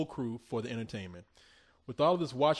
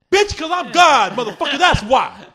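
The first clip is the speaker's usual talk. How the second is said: preacher yell; 11 semitones higher, 13 dB louder than usual. Retold or imitated. Crazy preacher yelling at a caller, loud and high. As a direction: roar it like a preacher losing his temper. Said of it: "He'd be like".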